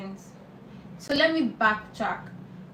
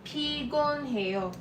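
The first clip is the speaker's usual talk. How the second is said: PIGON HEO drawn out slowly, each syllable separate; slow teaching demo of Pigon heo; 4 semitones higher, 4 dB quieter, slower than usual. Shown as a demonstration. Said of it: "four syllables".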